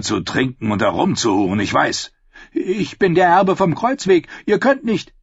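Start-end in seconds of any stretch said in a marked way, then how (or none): 2.5-5.1 high, loud, panicked begging; desperate pleading. A narrator's reading. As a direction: beg for your life, voice climbing. Doomed knight begging for mercy.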